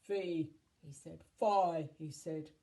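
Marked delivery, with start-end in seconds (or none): 0.0-0.5 loud, booming giant chant; one loud, falling giant call; hungry giant. A narrator's reading. Giant chanting before a meal. 1.4-1.9 loud, booming giant chant; one loud, falling giant call; hungry giant. A narrator's reading. Giant chanting before a meal.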